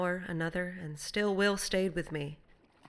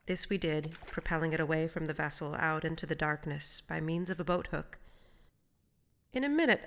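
ordinary delivery

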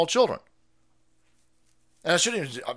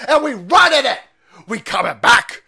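much louder, higher rally yell; hype shout; 8 semitones higher, 11 dB louder than usual. Real, not acted.

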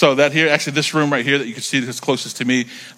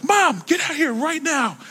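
very high, slow urgent call; high squealing summons; excited kid yell; slower than usual. Retold or imitated. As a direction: yell for mom in pure delight. Kid yelling excitedly for mom.

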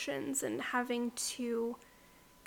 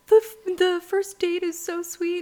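higher, louder mock gushing; hammed-up delight; 6 semitones higher, 7 dB louder than usual. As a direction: imitate him swooning over the date. Retold gushing text from husband.

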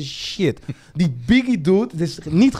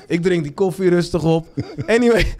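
usual speaking voice

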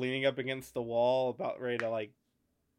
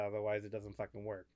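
ordinary talk, nothing marked

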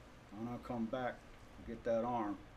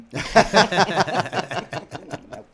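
loud laugh; 6 semitones higher, 14 dB louder than usual. Real, not acted. Laughing at own story.

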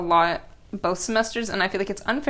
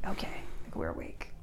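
hushed, barely voiced mock wake-up; low-key waking up; 12 dB quieter than usual. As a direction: murmur it softly, as if just waking. Waking up slowly in the morning.

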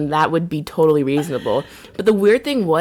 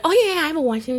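put-on much higher, eager kid voice; mock little boy bragging about his watch; kid's reply; 11 semitones higher, much faster than usual. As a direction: play the kid, keen to please. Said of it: "He's like".

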